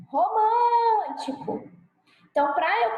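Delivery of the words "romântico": "Romântico" called out, middle syllable high and held long; calling out the word. Shown as a demonstration. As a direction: stretch the MÂN of "Romântico" like a call. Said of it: "chama a palavra". (chamando "Romântico", com o MÂN agudo e alongado)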